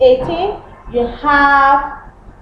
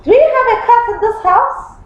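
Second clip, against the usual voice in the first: curious question; 9 semitones higher, faster than usual. Retold or imitated. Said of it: "Then you can now say".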